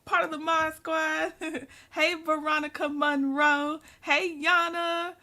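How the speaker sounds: ordinary delivery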